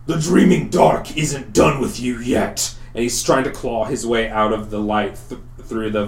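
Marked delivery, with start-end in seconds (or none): 0.1-2.8 higher-pitched cry of a searing monster; monster's raised, defiant cry; creature crying out. Acted. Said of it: "you hear the creature cry out".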